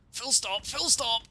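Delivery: high, urgent repeated order; urgent calling out. Real, not acted. Player frantically ordering his ship to halt, urgent high call. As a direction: call the order out, rising in alarm.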